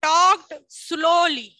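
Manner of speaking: stretched out slowly, word by word; slow dictation pace. Shown as a demonstration. Teacher to class, dictating slowly. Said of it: "ધીમેથી".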